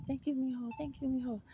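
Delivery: put-on high, soft grateful old woman; lightly voiced old grandma thanking him; hint of a frail old woman's thanks; old lady voice.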